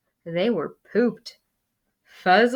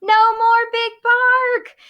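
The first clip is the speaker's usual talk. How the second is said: high, louder singsong cheer; gleeful cheer; 6 dB louder than usual. Acted. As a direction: crow with delight. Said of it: "the crowd cheered".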